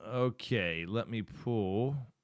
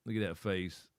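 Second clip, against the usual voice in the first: normal talking voice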